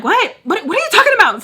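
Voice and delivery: loud high yelling. Retold or imitated. Nanny yelling at her boss on the phone, loud and high. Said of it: "So I'm, like, yelling at him".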